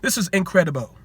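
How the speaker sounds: a hint of Magic Johnson's amazed tone; Magic Johnson impression. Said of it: "In my Magic, Magic Johnson voice".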